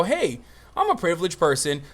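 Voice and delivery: normal talking voice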